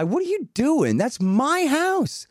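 high-pitched mock protest; imitated indignant lady. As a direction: voice an affronted woman confronting a trespasser. Lady confronts trespasser in retelling.

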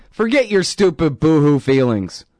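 firm scolding of everyone's feelings; forceful dismissal. Real, not acted. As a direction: bark it out as a put-down. Repeats phrase, second time louder.

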